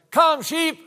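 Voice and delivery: put-on high, loud, forceful kid's call; stronger boy's yell. Big brother calling sheep after his sister fails, strong high yell. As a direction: yell it strongly like an eight-year-old. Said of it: "he had a much stronger voice".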